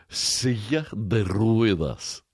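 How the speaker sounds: slow, drawn-out put-on voice; lazy mock crooning in Spanish; mock Spanish phrase. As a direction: savor each word lazily. Mimics a guy in recording booth.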